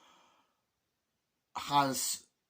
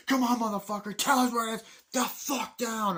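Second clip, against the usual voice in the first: high, loud imitation of a furious soldier; re-enacted aggressive yelling; acted angry interrogation; 11 semitones higher, 5 dB louder than usual. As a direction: bellow the demand in a strained high voice. Acts out Charlie Sheen's angry soldier.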